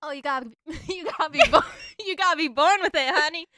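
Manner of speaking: laughing, loud Tennessee twang; Tennessee accent. Pretend. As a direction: laugh through a sassy Southern accent. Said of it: "how to do the perfect Tennessee accent".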